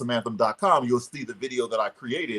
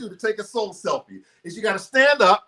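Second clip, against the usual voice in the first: raised, energetic coaching voice; motivational speaker guiding; 7 semitones higher than usual. Acted. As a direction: lead the whole room to their feet. Leads audience in soul selfie.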